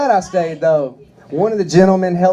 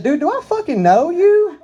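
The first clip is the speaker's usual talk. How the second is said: high, cracking outburst; snapped; 11 semitones higher than usual. Retold or imitated. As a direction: snap at the stranger, fed up. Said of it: "Oh, I just snapped".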